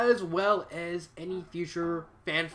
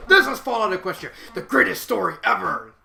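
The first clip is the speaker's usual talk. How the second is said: loud, higher-pitched mock fanboy proclamation; hyped-up fan imitation; 4 semitones higher, 8 dB louder than usual. Reviewer mimicking fans hyping their favorite story, over-excited.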